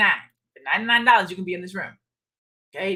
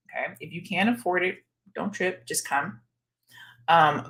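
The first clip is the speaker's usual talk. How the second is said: normal talking voice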